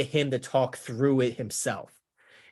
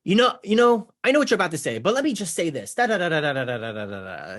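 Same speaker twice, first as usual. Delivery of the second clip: mock information dump; 4 semitones higher than usual. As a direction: cut in eagerly and rattle on. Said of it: "They're vomiting information for you".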